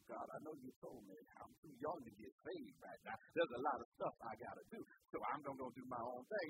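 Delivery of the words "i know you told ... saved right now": whispered excuse. Acted. Jonah answering God.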